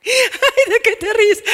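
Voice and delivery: put-on teen cracking up at a fly; laughing through words. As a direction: speak through the giggles.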